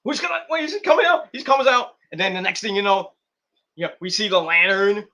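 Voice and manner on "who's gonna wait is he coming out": much higher, shouted disbelief; shocked crowd. Retold or imitated. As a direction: yell like a stunned fan when the lights die. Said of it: "everybody's like".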